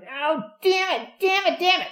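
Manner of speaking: high, loud whining curses; whiny cursing. Retold or imitated. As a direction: curse the car like a cartoon character. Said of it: "he had sort of a high, whiny voice".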